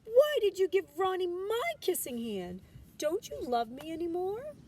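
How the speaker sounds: put-on upset child. A narrator's reading. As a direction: cry out the hurt question.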